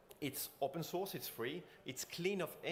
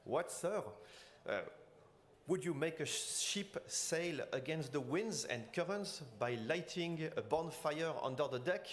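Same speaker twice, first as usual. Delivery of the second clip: Napoleon mock French accent, higher, slowed on the ship line; 4 semitones higher, slower than usual. Speaker impersonating Napoleon dismissing Fulton's steam engine, French accent. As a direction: ham up a French emperor's scorn in a French accent. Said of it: "clearing my throat for proper French accent".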